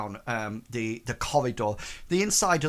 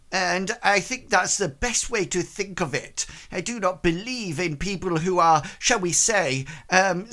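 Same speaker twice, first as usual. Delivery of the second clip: higher-pitched, formal lady's voice; prim woman character; 4 semitones higher than usual. Acted. Lady tells visitors everybody is equal, higher-pitched and formal. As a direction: hold forth in a higher, stilted register.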